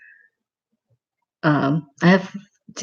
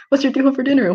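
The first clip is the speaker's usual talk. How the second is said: mock quote; 9 semitones higher, faster than usual. Mocking the chat question.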